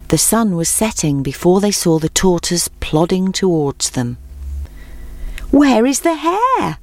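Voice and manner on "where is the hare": drawn-out, higher-pitched call. A narrator's reading. Animals asking the returning tortoise about the hare, calling out. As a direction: call it out slowly, pitched well up. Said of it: "they called out".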